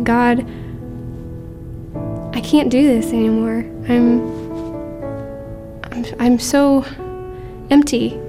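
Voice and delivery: ordinary talk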